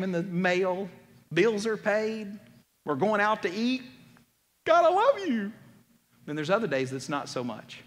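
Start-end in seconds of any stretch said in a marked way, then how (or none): 4.7-5.5 high, loud burst of grateful love; exuberant gratitude. Acted. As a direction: exclaim it joyfully, pitched way up. Delighted on a good day.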